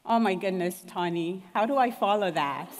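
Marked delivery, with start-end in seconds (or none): none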